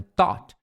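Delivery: "thought" said with a plain T, like "tot". Shown as a demonstration. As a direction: swap the TH of "thought" for a T. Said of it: "saia meio que T". ("thought" com som de T no começo)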